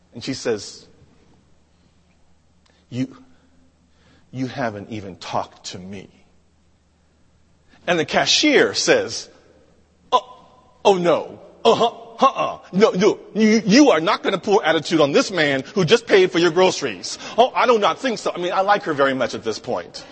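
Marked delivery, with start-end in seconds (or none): 2.9-6.1 low, soft, halting reproach; quiet, lowered voice; under her breath. Retold or imitated. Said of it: "under her breath". 10.1-18.3 sassy, emphatic scolding; mock outrage on someone's behalf; indignant cashier.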